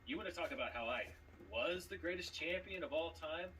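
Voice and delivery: normal talking voice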